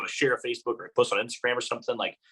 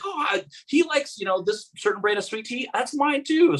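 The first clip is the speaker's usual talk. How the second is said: excited; 12 semitones higher than usual. Retold or imitated. Retelling a delighted fan reaction.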